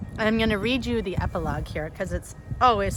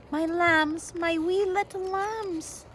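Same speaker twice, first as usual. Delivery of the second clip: tender relief; 10 semitones higher, slower than usual. A narrator's reading. Mother finds her children.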